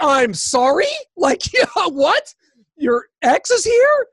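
loud, rising disbelief; incredulous reaction. Retold or imitated. Retold shocked reaction to her news.

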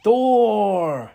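long, high, loud crowd cry; approving shout. A narrator's reading. Hundreds of warriors hail the rune, shouting approval. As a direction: roar the name and let it fall. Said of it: "hundreds of warriors shouted with approval".

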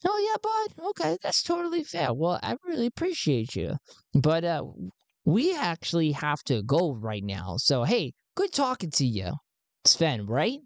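squeaky, bright friendly send-off; very high-pitched Garn. Acted. Garn says goodbye to Sven, pitched very high. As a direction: pitch way up, cheery.

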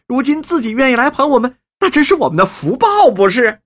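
high-pitched, grateful gushing; effusive old woman, overjoyed. Acted. Old woman accepts the patrons' offer, high and overjoyed. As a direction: gush with thanks, pitched high.

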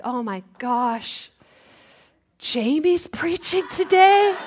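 slow groan rising to a high question; mock dismayed disbelief. Congregant finding out Jamie is preaching today, mock disbelief. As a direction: act put out by who turned up.

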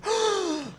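high, acted gasp of realization; sharp mock intake of breath; startled gasp. Caller notices how late it is, startled gasp.

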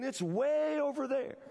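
"way" drawn out long and high; stretched WAY. Real, not acted. Preacher spotting the only empty seat, long high "way". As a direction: stretch "way" to show the distance. Stretches word to show distance.

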